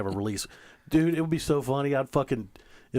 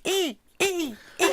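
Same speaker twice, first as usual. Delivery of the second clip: high-pitched squeak noises. Acted. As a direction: squeak like helium. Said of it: "it goes".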